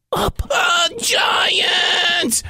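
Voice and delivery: high, stretched parrot shriek; alarmed parrot. Acted. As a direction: squawk in alarm at a giant. Parrot alarmed at seeing giant.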